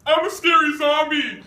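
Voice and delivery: loud, gas-altered zombie line with laughter; half-gone heavy-gas voice, amused; fading sulfur hexafluoride voice. Shown as a demonstration. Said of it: "Ah, it's going away".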